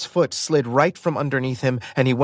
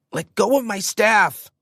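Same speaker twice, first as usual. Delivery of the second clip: angry shout; 9 semitones higher than usual. Acted. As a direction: yell to take back the staff. Struggle over the staff.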